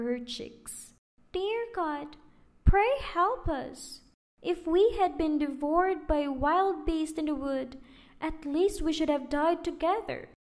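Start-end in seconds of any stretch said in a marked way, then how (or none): 1.3-10.3 put-on high, loud pleading child voice; pleading girl. A narrator's reading. Gretel praying to God while fetching water, high pleading. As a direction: beg heaven like a frightened little girl.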